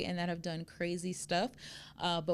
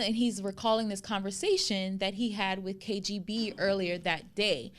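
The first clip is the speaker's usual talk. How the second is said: ordinary talk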